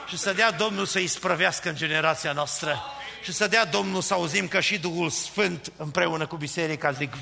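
ordinary delivery

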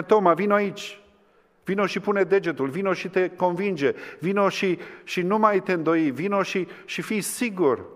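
high, urgent call to come; lightly voiced Lord pleading with Thomas; a hint of the Lord inviting. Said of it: "Și spune".

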